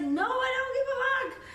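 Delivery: very high, loud flippant snap; bratty Glinda. Retold or imitated. Glinda dismisses Elphaba, high and bratty. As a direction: toss it off like a spoiled brat. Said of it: "Glinda's like".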